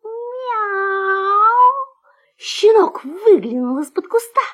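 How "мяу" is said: high, long meow; drawn-out cat meow. A narrator's reading. Someone above the hiding puppy's ear, high drawn-out meow. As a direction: imitate a cat's long meow. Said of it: "кто-то".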